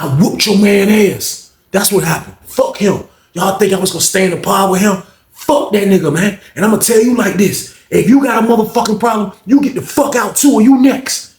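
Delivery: rising mock threat, building up; threatening. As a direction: square up and warn them. Fighter threatening roommate.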